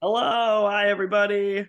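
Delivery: high, loud bright greeting; cheerful hello. Real, not acted. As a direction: say hi to everyone brightly. Guest greets listeners.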